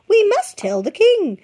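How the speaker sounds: high, loud fussing hen; urgent hen voice; flustered hen. A narrator's reading. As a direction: hen urges the chick on, flustered.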